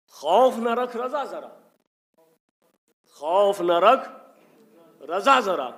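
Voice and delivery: high, loud recitation; preaching, verse called out loud; declaimed verse. Shown as a demonstration. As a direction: ring the line out to the hall.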